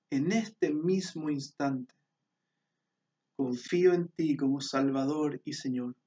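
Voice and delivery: hushed closing prayer. Acted. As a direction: pray it aloud, leading the listeners. Leads the prayer again.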